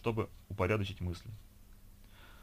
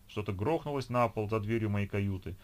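usual speaking voice

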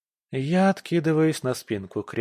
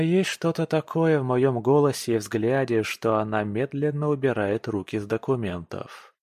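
usual speaking voice

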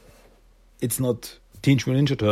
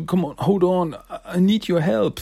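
higher, earnest pleading voice; pleading Silas; 8 semitones higher than usual. Acted. Silas explaining to Jenny he searched, higher pleading voice. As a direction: beg her to understand.

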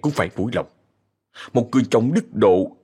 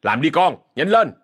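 higher, impatient hurrying command; snapped order; 6 semitones higher than usual. A narrator's reading. Step-uncle hurrying the boy to the oven, snapping impatiently. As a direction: hustle the boy along with a sharp rise. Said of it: "nạt thằng Thành".

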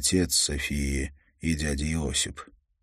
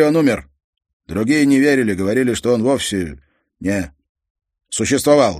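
loud, heavy-handed foreign father's speech; a proud immigrant father complaining; 5 semitones higher, 9 dB louder than usual. Acted. Croatian father tells the detective about his lost career, loud and blunt. Father continues war story.

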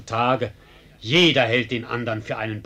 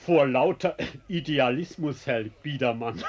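normal talking voice

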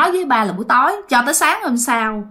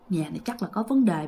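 inner thought; 4 semitones lower, 8 dB quieter than usual. Retold or imitated. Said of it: "bạn nghi trong bụng rồi ha".

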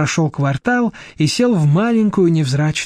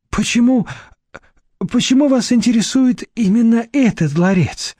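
high bewildered question; surprised; 6 semitones higher than usual. A narrator's reading. Deputy director asks Nadezhda why she wants the casket, surprised. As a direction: ask back, puzzled and uneasy. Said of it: "удивлённо переспросил".

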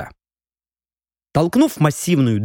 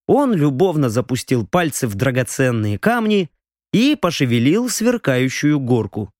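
plain reading voice

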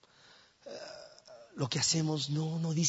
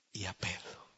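whispered; 5 semitones lower, 7 dB quieter than usual. Acted. Jesus to the women, whispering Peter's name.

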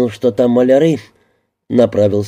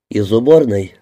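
ordinary delivery, nothing marked

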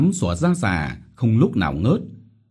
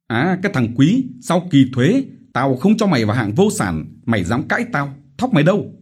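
higher, louder, menacing demand; angry interrogation; 7 semitones higher, 4 dB louder, faster than usual. Acted. Chief demanding Quý's missing rice, angry shouting. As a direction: corner him with your anger.

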